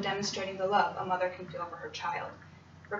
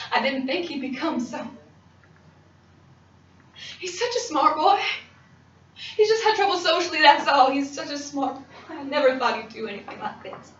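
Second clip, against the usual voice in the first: upset, mostly higher-pitched pleading; distraught pleading; 7 semitones higher, 7 dB louder than usual. Acted. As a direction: plead for your son through the upset. Distraught mother defends her son.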